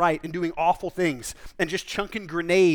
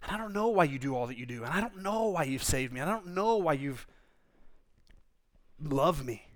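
much softer, hushed confession to God; humble quiet prayer; 3 semitones lower, 7 dB quieter than usual. Acted. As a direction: pray it softly, baffled and humbled. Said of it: "and say".

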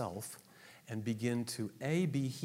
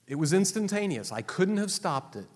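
emphatic outburst; 7 semitones higher, 7 dB louder than usual. Acted. Emphatic confession.

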